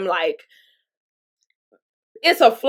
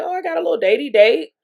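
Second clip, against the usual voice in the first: excited; 6 semitones higher than usual. Retold or imitated. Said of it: "So I'm excited".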